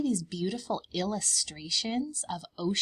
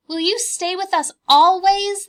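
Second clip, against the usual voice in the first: high, louder little cub asking; 11 semitones higher, 13 dB louder than usual. Acted. Bear cubs asking Mama, high child voice. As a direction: pipe up like a curious bear cub.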